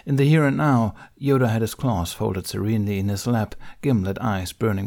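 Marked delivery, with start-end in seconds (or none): none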